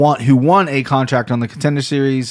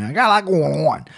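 a light sung Ohio accent demo; 3 semitones higher than usual. Shown as a demonstration. Host demoing the Ohio accent for listeners, lightly sung. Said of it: "That's what Ohio people sound like".

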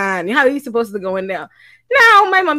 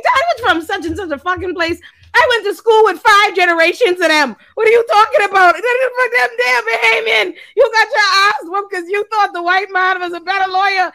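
worked-up, higher retelling of the comeback to dad; retorting to dad; 7 semitones higher, 5 dB louder than usual. Retold or imitated. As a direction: fire it back at dad, higher and louder. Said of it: "I say".

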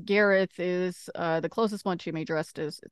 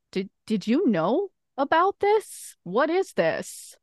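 higher, slow, edgy questioning; wary question; 8 semitones higher, 6 dB louder, much slower than usual. Acted. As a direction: ask it on edge, a little wary. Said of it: "she is a little wary".

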